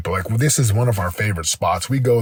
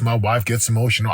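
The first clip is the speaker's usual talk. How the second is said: ordinary delivery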